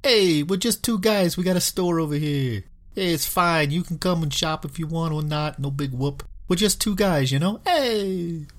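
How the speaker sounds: high, casual Philly Jersey guy; Philly Jersey accent. Retold or imitated. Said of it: "more Philly, Jersey sounding name".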